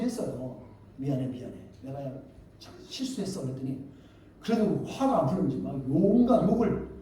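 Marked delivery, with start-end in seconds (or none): 1.0-3.4 low, soft retold apology; subdued apology. Preacher retells apologizing to an angry man, subdued. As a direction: say sorry quietly, backing down.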